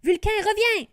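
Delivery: high, loud call after a dragon; shout. Acted. Rory shouting for her dragon to return, loud call. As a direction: call the dragon back at full voice. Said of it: "lui crie Rory".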